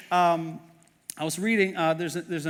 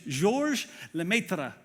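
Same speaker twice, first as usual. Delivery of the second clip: slow, lightly attempted French accent; French accent on the name, drawn out slowly; much slower than usual. Shown as a demonstration. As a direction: give the name your best French pronunciation. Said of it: "That's my best French accent".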